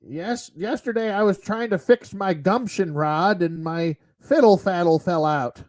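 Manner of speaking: lightly voiced old geezer; a hint of a rambling old man; old man Sean Connery voice. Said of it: "weird, like, old man Sean Connery awkward voice".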